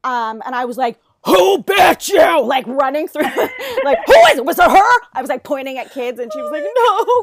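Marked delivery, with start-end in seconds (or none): 1.3-2.4 loud, high, slow frantic yell; frantic shout. Retold or imitated. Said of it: "lost my mind immediately". 4.1-5.0 loud, high shouting; frantic shout. Retold or imitated. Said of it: "lost my mind immediately". 6.6-7.2 high, loud, teary no; crying child. Retold or imitated.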